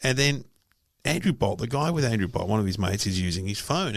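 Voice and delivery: normal talking voice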